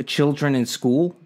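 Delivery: ordinary delivery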